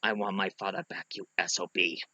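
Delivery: put-on low, very soft accented menace; Inigo Montoya. Swordsman stabbing the Count at last, low hushed line. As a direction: say it low and quiet to the enemy. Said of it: "stabbed the Count and said".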